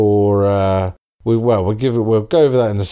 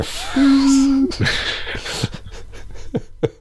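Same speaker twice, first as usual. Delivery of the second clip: squeaky laugh. Pretend.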